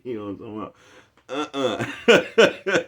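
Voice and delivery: high, mocking women's voice with a laugh; imitating the women. Mocking the women he talked with last night, high and laughing. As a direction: put on how those women talk. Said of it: "This is the way them hoes talk".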